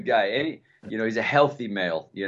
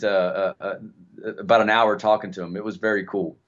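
normal talking voice

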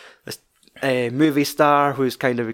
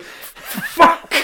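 high, breathy mock yell through a laugh; frustrated shout; 3 dB louder than usual. Actor raging at himself in the trailer, high mock yell. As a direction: blurt it out in fury, half laughing. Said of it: "throws it down".

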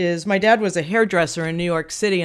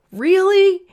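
high, drawn-up questioning echo of dad; incredulous dad; 12 semitones higher, 5 dB louder than usual. Retold or imitated. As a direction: imitate a dad taken aback. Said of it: "he said".

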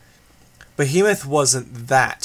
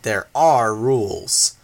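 mock-solemn rules; slower than usual. A narrator's reading.

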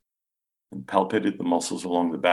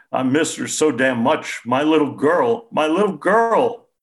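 higher and louder, cracking into a wail; choked out through tears; 9 semitones higher, 8 dB louder than usual. A narrator's reading. Grieving father to the doctor, choked out through tears. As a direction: choke the words out through sobbing. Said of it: "he choked out".

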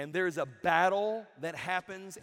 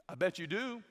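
ordinary delivery

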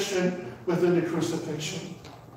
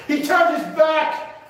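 higher, emphatic, grieving proclamation; impassioned preaching; 5 semitones higher than usual. Real, not acted. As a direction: drive the painful point home. Preacher at full force.

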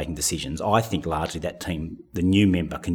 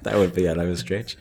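laughing; 3 semitones higher, 4 dB louder than usual. Real, not acted. Laughs at own answer.

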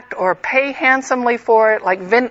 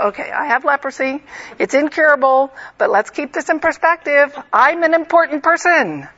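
Naaman's wounded pride, pitch climbing higher toward the last line; building self-important outrage, rising higher; indignant Naaman; 5 semitones higher than usual. Retold or imitated. Naaman grumbles to himself about being slighted, building to higher indignation. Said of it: "he must have thought".

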